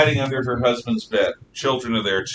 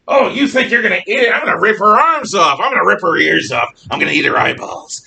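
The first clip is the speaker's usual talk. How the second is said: loud, high, rapid one-upping threat; mob shouting; 7 semitones higher, 6 dB louder, faster than usual. Retold or imitated. Second rioter outdoing the first, shouting through the palace. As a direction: bark it, topping the last rioter. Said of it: "they're just shouting them at the top of their lungs".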